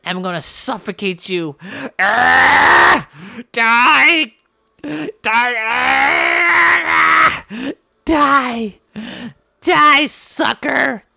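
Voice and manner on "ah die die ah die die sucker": loud hammed-up yells of die; high, loud play-fight shouting; mock battle cries. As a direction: scream like winning a cartoon fight.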